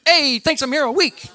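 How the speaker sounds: very high sing-out punchline tag; hammy comedian catchphrase. Retold or imitated. Wife stealing husband's catchphrase after her pun, high hammy call. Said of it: "then I'll go".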